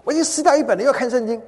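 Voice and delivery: loud, high-pitched scolding; angry outburst. Retold or imitated. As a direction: act out an exasperated complaint. Acted angry quarrel quote.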